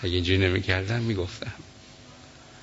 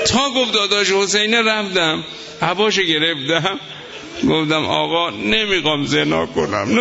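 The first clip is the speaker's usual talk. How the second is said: impassioned preaching; 10 semitones higher, 4 dB louder than usual. Acted. Preacher recounting the martyr's dream to mourners, impassioned wail. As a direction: wail the story out to the crowd. Preacher builds to emotional climax.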